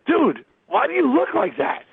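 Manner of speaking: high, exclaimed disbelief; mock incredulous. Rock star questioning radio host's look, mock incredulous. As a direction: call it out high, appalled at the outfit.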